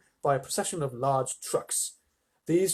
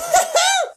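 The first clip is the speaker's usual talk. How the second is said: very high, loud delighted laugh; gleeful old man's cackle; 9 dB louder than usual. Acted. Grandpa Joe dancing round the room, very high cackle. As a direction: cackle with joy. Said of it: "shouted Grandpa Joe".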